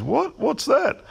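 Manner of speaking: imitating a clueless bloke, high-pitched; baffled. Old workmate asked for correction tape, mock baffled. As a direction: squeak it out, mock dumbfounded. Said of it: "he looked at me stupid".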